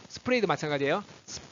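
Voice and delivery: ordinary delivery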